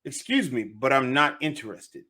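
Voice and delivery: ordinary talk, nothing marked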